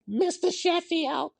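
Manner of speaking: very high, slow sitcom nanny call; drawn-out, high-pitched nanny impersonation; Fran Drescher. Acted. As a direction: squeal it out in a Flushing, New York voice. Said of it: "she sounded like Fran Drescher from The Nanny".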